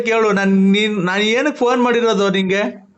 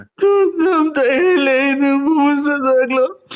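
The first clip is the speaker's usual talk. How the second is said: higher, slow, sing-song wail; wailing cry; 6 semitones higher, much slower than usual. Acted. Friend on the phone cries over his lost love, slow sing-song wail. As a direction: wail it out like a half-sung lament. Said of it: "ಅಳ್ಬೇಡ".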